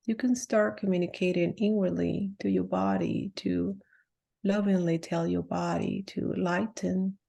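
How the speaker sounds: softly spoken meditation with long pauses; guided meditation. Real, not acted. Healer telling listeners to let the body drop and relax, guided meditation voice. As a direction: lead them into relaxation, letting each phrase drop and settle.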